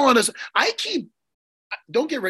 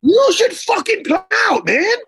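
much higher and louder, fired-up insistence; emphatic invitation; 11 semitones higher, 6 dB louder than usual. Real, not acted. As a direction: push the invite hard, all in. Inviting friend to the fight.

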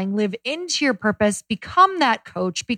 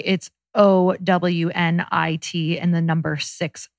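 O W N I T spelled slowly letter by letter; spelling it out; 3 semitones lower, slower than usual. Shown as a demonstration. Cohost spelling the web address for listeners, slow. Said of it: "and by the way".

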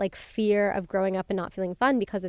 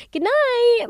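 much higher, loud sing-song good night; breezy goodbye; 8 dB louder than usual. Retold or imitated. To her friend's face after he calls her lame, loud breezy good night. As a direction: toss it off cheerfully dismissive.

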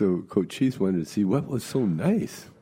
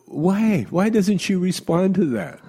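higher, louder puzzled complaint; puzzled old lady; 11 semitones higher, 5 dB louder than usual. Retold or imitated. Old lady baffled by her phone, high and loud. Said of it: "She says".

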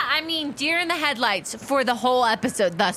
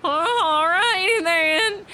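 high, slow, drawn-out pony voice; reluctant Tangerine; 8 semitones higher, much slower than usual. Acted. Tangerine gives in to being told to shop, high reluctant drawl. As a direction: drag out a high, put-upon agreement.

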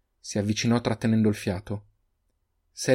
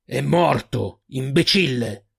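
slow, louder, furious outburst; furious yell; 3 semitones higher, 4 dB louder, much slower than usual. A narrator's reading. Old man yelling at the boy, furious. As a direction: bite out each word in rage. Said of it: "urlò Agal furente".

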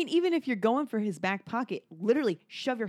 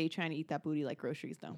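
quiet joke; 6 semitones lower, 10 dB quieter than usual. Retold or imitated. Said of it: "I was gonna say".

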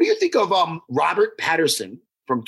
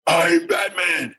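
very slow, drawn-out hero shout; hammed-up superhero voice; much slower than usual. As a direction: stretch each word like a hammy masked vigilante. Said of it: "now I'll do it".

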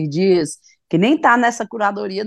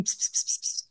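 soft unvoiced psst-psst calling a cat; imitating a catcall; 11 dB quieter than usual. Boy on the beach calling the girl like a cat, soft hissing psst. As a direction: hiss psst psst like calling a kitty. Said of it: "ele chamando assim".

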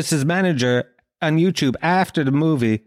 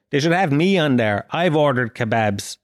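ordinary delivery

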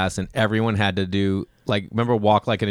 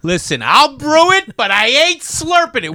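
loud, high-pitched comic outburst; mock indignant refusal; 14 semitones higher, 7 dB louder than usual. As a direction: put your foot down, hammed-up and loud. Mock outraged refusal about slurping.

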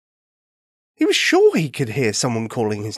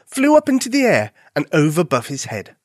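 ordinary delivery

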